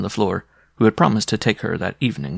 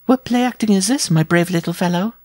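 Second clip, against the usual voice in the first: high-pitched woman's teasing question; amused giantess; 10 semitones higher, 3 dB louder than usual. A narrator's reading. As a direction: talk down to a small suitor, smiling. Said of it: "with the smile of an ogress".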